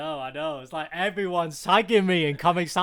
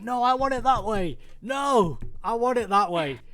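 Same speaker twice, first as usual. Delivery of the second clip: insistent, higher whiny demand; stubborn designer; 6 semitones higher than usual. Retold or imitated. Designer demanding it his way from developers, pitched higher. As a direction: dig in like a precious designer who won't budge. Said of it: "I used to be like".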